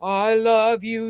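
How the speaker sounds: slow, open yawning throat; slow yawned demo; yawned speech. Shown as a demonstration. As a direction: speak it slowly through a yawn.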